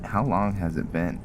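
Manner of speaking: ordinary talk, nothing marked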